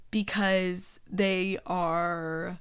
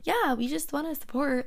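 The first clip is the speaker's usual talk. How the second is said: higher, earnest mocking voice; mock loser grad; 7 semitones higher than usual. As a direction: parody a clingy ex-student. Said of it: "those fucking high school graduate losers".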